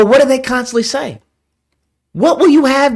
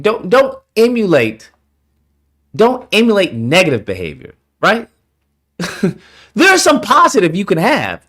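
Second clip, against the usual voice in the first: usual speaking voice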